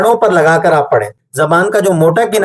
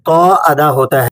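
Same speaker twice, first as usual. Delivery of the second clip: ordinary delivery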